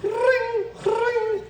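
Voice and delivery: two short, high trilled rings; high-pitched telephone trill, cut short twice; imitated phone ring. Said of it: "We were two shorts".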